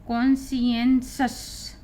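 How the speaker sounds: slow, syllable-by-syllable "कॉन्शियंसस", kon-si-en-shus; pronunciation demo. Shown as a demonstration. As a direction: spell out "कॉन्शियंसस" slowly in four beats, ending in shus. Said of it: "तो इसको हम पढ़ेंगे".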